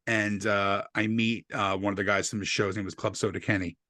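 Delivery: ordinary talk, nothing marked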